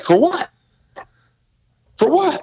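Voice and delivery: high, baffled for what; incredulous. Retold or imitated. Sportscaster in Dallas scoffing at the tape. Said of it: "I'm like".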